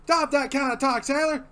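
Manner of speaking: high, loud barking sergeant; drill sergeant. Retold or imitated. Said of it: "like a drill sergeant".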